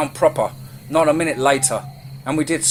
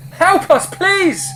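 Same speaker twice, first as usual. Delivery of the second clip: high-pitched pleading yell, very loud; desperate shout; 7 dB louder, slower than usual. A narrator's reading. Fleeing man begging townsfolk for rescue, desperate shout. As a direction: shout your plea to the whole town. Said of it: "Moses shouted".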